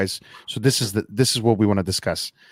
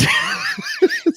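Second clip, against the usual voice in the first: high laugh. Real, not acted. Host laughing at his friend's joke in the chat, high laugh. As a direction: burst out laughing.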